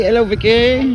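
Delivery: high, sing-out greeting; calling out hello. Real, not acted. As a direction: call hello to someone you have just spotted. Greets Vicky spotted in passing.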